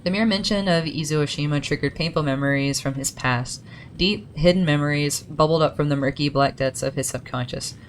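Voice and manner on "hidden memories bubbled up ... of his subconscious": normal talking voice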